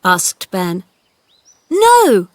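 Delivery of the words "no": very high, loud no; shouting. A narrator's reading. Boy angrily denying to the gardener, shouting. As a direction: bark it back furiously. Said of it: "shouted Colin".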